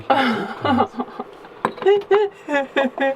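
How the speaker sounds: high squeaky laughing tease. Real, not acted. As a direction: break into laughter mid-line. Teasing partner, laughing.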